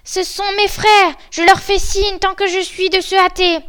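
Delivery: high, urgent announcement; excited lookout. Acted. Sister Anne recognises the brothers coming, excited. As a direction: call down the good news.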